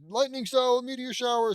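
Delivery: shouted high and loud, calling out the attack; yelled jutsu name. Acted. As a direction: belt out the technique name like an anime hero. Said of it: "they all fucking heard you yell it".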